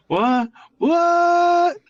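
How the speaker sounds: high, rising alarm; rising disbelief from mild to shrill; shocked disbelief. Real, not acted. Reading shocking chat message.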